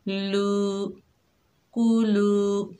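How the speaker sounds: लू and कुलू held one alif long, slow. Shown as a demonstration. Teacher demonstrating a madd to students, stretched कुलू. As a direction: draw out कुलू. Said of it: "एक अलिफ की मिकदार खींच कर पढ़ेंगे".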